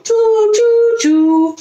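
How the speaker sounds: high whistling choo choo; train whistle. Pretend. Train recap.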